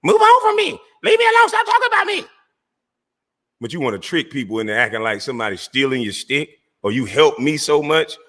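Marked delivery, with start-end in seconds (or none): 0.0-2.2 very high, louder squeaky mock voice; mocking imitation. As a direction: parody his whiny plea to be left alone. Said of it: "what you always tell people".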